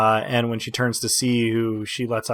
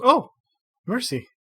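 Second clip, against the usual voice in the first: flustered laugh; 6 semitones higher, 5 dB louder than usual. Acted. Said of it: "she goes".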